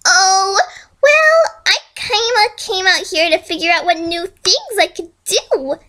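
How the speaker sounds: chirpy villain. Acted.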